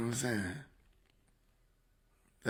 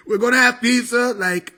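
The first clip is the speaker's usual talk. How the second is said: Donald Trump; 6 semitones higher than usual. Acted. Comedian as Trump promises unprecedented pizza, impression. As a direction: sell it like a comic presidential speech. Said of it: "Trump impression was spot on".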